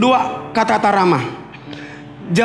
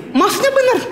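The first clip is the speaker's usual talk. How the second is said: higher, shrill-ish demo of a high tone; high-pitched; 12 semitones higher than usual. Shown as a demonstration. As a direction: show how a high tone sounds. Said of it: "Nada tinggi itu kayak gini". (istri bicara dengan nada tinggi)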